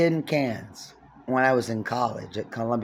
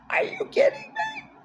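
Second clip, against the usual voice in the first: incredulous. Real, not acted. Incredulous rhetorical question.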